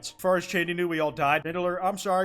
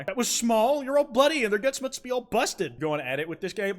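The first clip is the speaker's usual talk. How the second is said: ordinary talk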